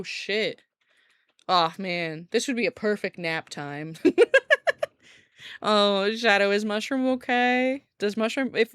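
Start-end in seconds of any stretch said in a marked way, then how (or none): 4.0-4.8 high laugh. Real, not acted. Joking about nap time.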